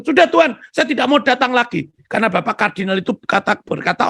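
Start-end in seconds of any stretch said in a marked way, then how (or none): 0.0-1.9 higher, louder done-with-it declaration; exasperated man telling God he quits; fed-up refusal. Retold or imitated. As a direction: slam the door on it. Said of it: "dia sudah memutuskan".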